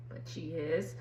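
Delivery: a snide little aside; muttered aside. Real, not acted. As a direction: drop it under your breath. Sarcastic aside after defending Mia.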